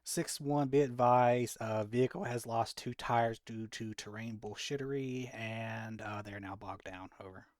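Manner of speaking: loud clipped radio call; police radio. Acted. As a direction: report in like on comms. Radio report to command.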